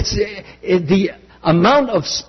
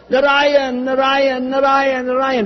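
imitated crying out; 8 semitones higher than usual. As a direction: call the name over and over in a mock desperate wail. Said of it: "scream and cry and yell".

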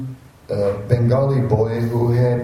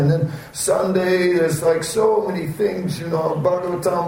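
high-pitched, put-upon excuse-making; mock complaining; 6 semitones higher than usual. Devotee excusing himself from temple Sunday, mock high complaint. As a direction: plead busyness in a hammed-up high voice.

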